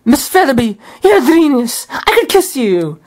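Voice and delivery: high excited praise; delighted Scrooge. Acted. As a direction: gush at the secretary's genius.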